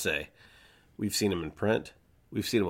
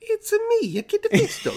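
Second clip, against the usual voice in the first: much higher cartoon Italian plumber voice; Mario voice; 4 dB louder than usual. Host joking to cohost, doing Kit Fisto as Mario. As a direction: squeak it out like Mario. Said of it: "Like, like a Mario".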